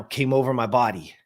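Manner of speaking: ordinary talk, nothing marked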